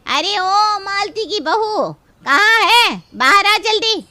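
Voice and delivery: loud, high, drawn-out call to come out; angry yelling. Acted. Neighbour summons the daughter-in-law outside, yelling at full throat. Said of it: "क्यों इतना गला फाड़ रही है?".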